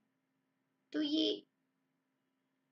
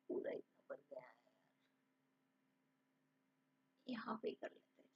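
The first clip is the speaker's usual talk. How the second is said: very soft, half-whispered aside; talking to herself; 11 semitones lower, 13 dB quieter than usual. Real, not acted. Teacher hunting for writing space, muttering to herself. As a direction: mutter it under your breath, barely audible.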